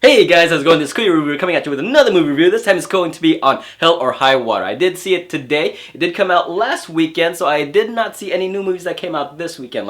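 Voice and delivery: loud, high, fast show-opening pitch; host intro. Acted. YouTube reviewer opening his show, fast loud host voice. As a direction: hype up the channel like a host.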